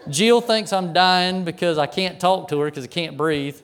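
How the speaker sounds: ordinary talk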